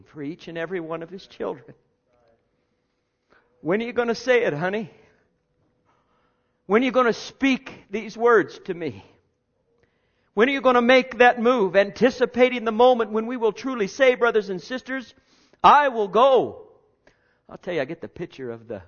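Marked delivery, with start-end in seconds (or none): 3.6-11.8 higher, louder, eager pleading; God pleading. Acted. Preacher voices God waiting. 15.6-16.7 proclaimed. Acted. Climactic response voiced.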